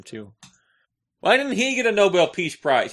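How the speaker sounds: usual speaking voice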